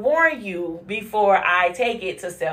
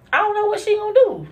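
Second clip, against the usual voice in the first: high, fast exclamation; 3 dB louder, faster than usual. Real, not acted. Fearing her own temper.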